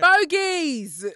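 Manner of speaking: high, loud drawn-out holler; mock TV shout. Joking about children's TV, mock high shout. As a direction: holler it like a kids' show catchphrase.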